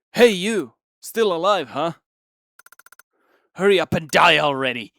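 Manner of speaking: loud jeering taunt; angry shouting. Acted. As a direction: yell it with contempt. Hostile voice taunting player.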